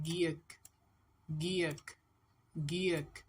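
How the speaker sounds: गीयक said as "gi-yek", repeated with pauses; letter-name drill. Shown as a demonstration. Teacher has learners voice the letters, letter-name drill. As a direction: sound out गीयक separately each time. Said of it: "इनकी आवाज़ भी निकालें".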